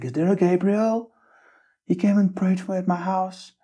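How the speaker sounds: lightly put-on high woman's voice; excited woman on stage. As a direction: play the woman calling out from the stage.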